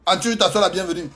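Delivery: ordinary talk, nothing marked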